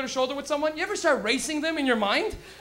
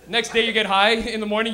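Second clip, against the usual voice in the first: normal talking voice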